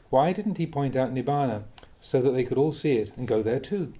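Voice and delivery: normal talking voice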